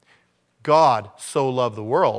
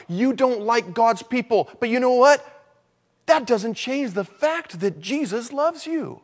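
higher, slow pleading preacher voice; fervent preaching; 8 semitones higher, slower than usual. Acted. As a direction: plead with the lost soul, slow and lifted. Preaching directly to the sinner.

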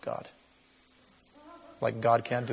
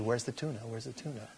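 small, soft questioning cat; oblivious cat; 13 dB quieter, faster than usual. Acted. Preacher voices the cat missing the tuna, soft oblivious cat. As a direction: play a clueless cat under the voice. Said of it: "the cat's just looking at you".

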